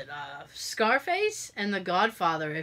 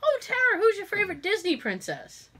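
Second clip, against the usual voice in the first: put-on much higher, sweet baby-talk voice; high sugary grown-up to child; 11 semitones higher than usual. Therapist asks the adult patient about princesses, much higher and sweet. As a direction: talk to her like she's seven. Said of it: "looks at me and goes".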